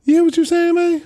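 mock shocked yell; imitating Wolf. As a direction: shout it in disbelief. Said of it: "he's like".

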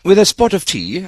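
hammed-up English accent; mock British accent. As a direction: play at being terribly British. Host joins in the British accent.

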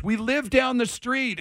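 high, slow mock yell; imitated kid's yell. Kid gives his address to the homeowner, mock yelling. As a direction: call it out like a kid, without real volume. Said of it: "he's yelling his address at me".

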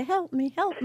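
high, pitiful damsel cry; mock plea for help. Host jokes about flagging down help on the road, hammed-up pitiful plea. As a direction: squeak it out like a stranded helpless driver. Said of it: "stick my leg out in the road and say".

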